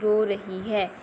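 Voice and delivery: ordinary talk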